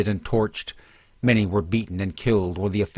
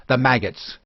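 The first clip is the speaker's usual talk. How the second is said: mob jeer; 4 semitones higher than usual. A narrator's reading. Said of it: "cried voices".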